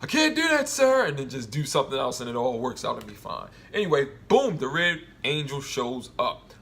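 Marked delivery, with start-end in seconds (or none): 0.0-1.1 much higher, louder sing-song refusal; mock defiant refusal. Mocking the crew who refuse orders, in a high loud sing-song. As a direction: parody the stubborn officer, pitched way up. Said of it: "who's gonna be the next person to say".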